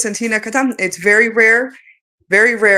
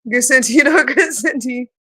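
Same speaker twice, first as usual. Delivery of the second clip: light language; 4 semitones higher than usual. Acted. Speaker channeling her higher self, calling out. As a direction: proclaim the syllables like a call.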